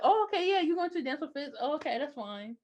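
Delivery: lightly imitating a hurt guy pretending it's fine; mock hurt boyfriend. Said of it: "he's just like".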